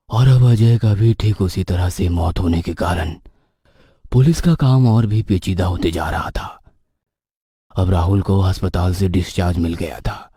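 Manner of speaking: ordinary delivery, nothing marked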